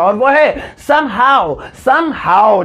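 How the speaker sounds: somehow drawn out slowly; drawn-out reveal. Shown as a demonstration. Teacher revealing the word somehow to students, slowly. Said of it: "vocabulary word बताता हूं".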